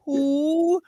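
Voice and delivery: high, put-on cantankerous diva. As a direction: pitch it way up, dismissing the caller. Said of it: "that was my version of [name]".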